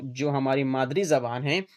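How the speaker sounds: ordinary talk, nothing marked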